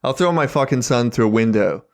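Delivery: ordinary delivery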